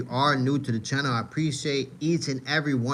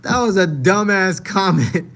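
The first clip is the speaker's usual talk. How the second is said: loud, slow, higher, with a laugh after; amused disbelief; 6 semitones higher, 7 dB louder, slower than usual. Real, not acted. Streamer mocks a fighter's comment, loud and laughing. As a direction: stretch it out loudly, still amused.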